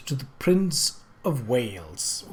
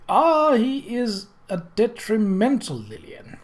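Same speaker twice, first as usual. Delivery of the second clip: higher, slow, lazily amused; lazy smile; 8 semitones higher, slower than usual. A narrator's reading. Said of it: "smiling lazily".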